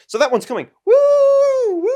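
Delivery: high, loud whooping hype; yelling woo with a laugh at the end; excited whoop. Real, not acted. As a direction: cheer like a hype man. Hyping the coming video.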